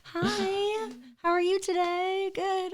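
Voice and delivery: very high, singsong politeness; sugary-sweet greeting. Acted. Shows her new nicer self.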